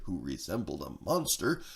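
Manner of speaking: usual speaking voice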